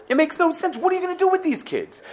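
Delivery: high pitched bewildered question; mock Pharaoh, high and incredulous; baffled Pharaoh. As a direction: ask it high, half-laughing in disbelief. Paro baffled by the kids.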